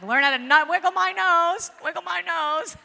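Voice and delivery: very loud, high-pitched mock frustration; comic outburst. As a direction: belt it out high, hamming it up for laughs. Clowns about Bewitched nose wiggle.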